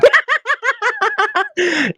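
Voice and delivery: high loud laugh cracking up; burst of laughter. Real, not acted. Host dropping the whisper bit, laughing loudly. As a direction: let the laugh explode out.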